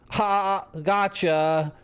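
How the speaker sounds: high, playful gotcha; teasing gotcha. Real, not acted. Streamer teasing viewers with a fake photo, playful gotcha. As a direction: catch the chat out with a grin.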